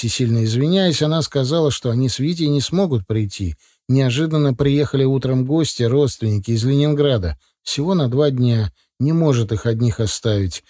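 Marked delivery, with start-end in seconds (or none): none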